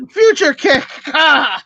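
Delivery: high loud battle cry; attack cry. Acted. Shouting a kick move at enemy, high loud.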